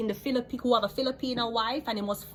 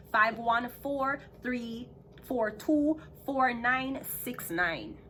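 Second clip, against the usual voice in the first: slow, careful digit-by-digit read-out; deliberate slow number dictation; 4 semitones higher, much slower than usual. Real, not acted. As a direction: spell out the number slowly so it can be written down. Reading a phone number out.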